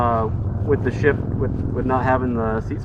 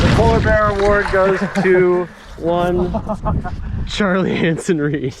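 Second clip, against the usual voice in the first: announcer voice; 4 semitones higher, 4 dB louder than usual. Pretend. Mock award announcement.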